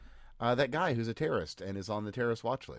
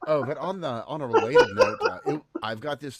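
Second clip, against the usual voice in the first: laughing; 4 semitones higher, 9 dB louder than usual. Real, not acted. Still laughing.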